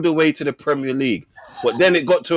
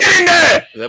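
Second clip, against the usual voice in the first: high, loud cheer; excited shout; 8 dB louder, much slower than usual. Real, not acted. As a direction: shout it out like celebrating a goal. Celebrating a point in banter.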